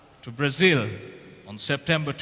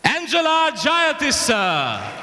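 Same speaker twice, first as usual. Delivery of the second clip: excited announcement; 10 semitones higher, 3 dB louder, slower than usual. Real, not acted. Announcing the second runner-up.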